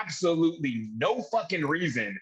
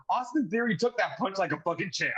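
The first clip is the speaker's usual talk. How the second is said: ordinary talk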